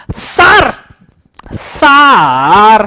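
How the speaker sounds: high, loud, eager student calling sir; a keen pupil calling out to get the teacher's attention; eager student Champat. Retold or imitated. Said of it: "Champat मेरे को बोला था". (उत्सुक छात्र की ऊँची आवाज़)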